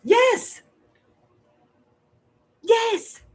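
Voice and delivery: excited yes. Real, not acted. Emphatic agreement, very high.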